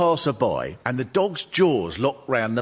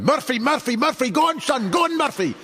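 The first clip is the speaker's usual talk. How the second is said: much higher, calling Murphy across the pub; calling the dog; 12 semitones higher than usual. Retold or imitated. Pub bloke cheers on his dog Murphy with a high calling voice. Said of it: "I heard this bloke going".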